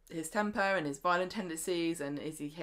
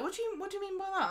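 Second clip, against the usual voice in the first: much higher, fast startled question; taken aback; 14 semitones higher, 3 dB quieter, much faster than usual. Retold or imitated. Host voicing Summer alarmed by Todd, high fast surprise. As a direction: caught off guard and suspicious. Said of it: "And she's like".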